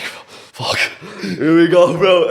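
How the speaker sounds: blurting out nervous dread; retold dread.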